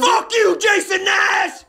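high, full-volume imitated scream; enraged mock holler; screaming stranger. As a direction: bellow it slowly, stretching the name. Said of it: "The guy just goes".

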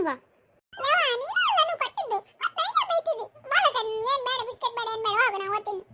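put-on high, wailing child voice; upset child's protest. Scolded child complains to mother about being blamed, high tearful protest. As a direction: whine it out, pitched far up.

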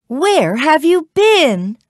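high, loud, drawn-out mother; demanding question. A narrator's reading. Mother questions Jack arriving home, demanding. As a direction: stretch out a worried scolding question.

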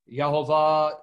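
"Yehovah" said as yah-ho-VAH; Hebraized Yehovah. Shown as a demonstration. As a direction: open first syllable in "Yehovah", Y not J. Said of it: "however you wanna pronounce it".

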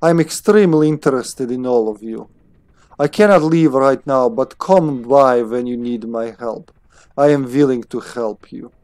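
scientist with a put-on German accent; deliberate, accented German English. Scientist offering player help, German accent. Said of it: "supposed to have a German accent".

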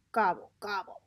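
low, soft turkey grumble; grumbling. A narrator's reading. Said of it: "grumbled Turkey".

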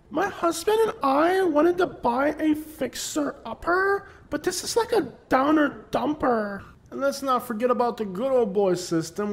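lampoon a California newcomer; high prissy mock voice; mock transplant voice.

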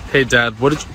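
ordinary delivery

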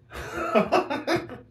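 very high, loud manic laugh; manic cackle. Pretend. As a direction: cackle like a gloating brawler. Manic laugh after taunt.

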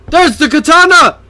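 high excited yell; thrilled, high-pitched exclamation; excited shout. Real, not acted. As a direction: call out the find, loud and high. Spots the hidden item in game.

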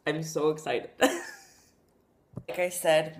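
ordinary talk, nothing marked

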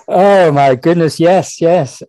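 slow, high, loud gasp of embarrassed surprise; flustered surprise. Real, not acted. Host asks him about the audiobook, he answers in flustered surprise. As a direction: caught out and delighted at once.

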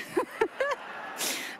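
high airy laugh; breathy laugh. Real, not acted. Laughter after being blamed.

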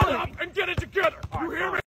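high, strained scolding; yelling. Acted. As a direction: order them about at a high pitch. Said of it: "why are you always yelling?".

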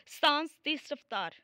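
ordinary delivery, nothing marked

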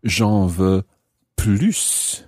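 plus ending on a clear, held S; slowly, "plus" as plooss with the S heard; sounded final S. Shown as a demonstration. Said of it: "when you pronounce the S of plus".

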